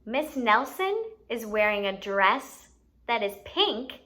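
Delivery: ordinary talk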